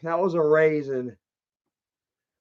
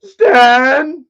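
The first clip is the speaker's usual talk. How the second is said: loud, much higher shout for a friend; calling out; 8 semitones higher, 11 dB louder than usual. Shown as a demonstration. Shouting for Stan to see if he's home. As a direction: yell his name across the house. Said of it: "That's what I'm gonna say".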